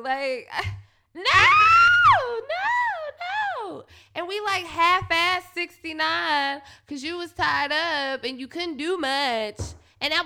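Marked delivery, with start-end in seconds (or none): 1.1-3.8 high wailing shouted no; mock scream. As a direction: scream it out like a cry, half joking. Shouting no in retold scene.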